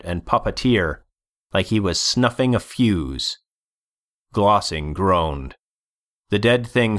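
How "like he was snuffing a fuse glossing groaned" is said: plain reading voice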